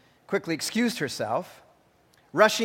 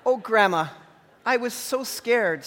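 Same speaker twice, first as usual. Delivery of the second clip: mild mock sob; 6 semitones higher than usual. A narrator's reading.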